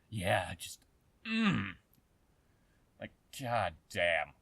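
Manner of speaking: ordinary delivery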